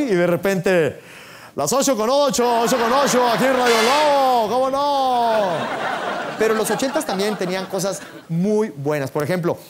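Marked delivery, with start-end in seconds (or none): 1.6-5.8 drawn-out station announcer call; radio announcer parody. Retold or imitated. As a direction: barge in like a radio DJ, stretching the endings. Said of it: "el mendigo locutor te la echaba a perder".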